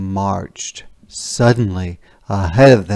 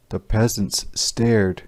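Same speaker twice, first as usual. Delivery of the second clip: ordinary delivery, nothing marked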